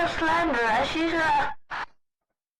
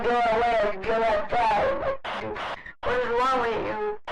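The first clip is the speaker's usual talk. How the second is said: usual speaking voice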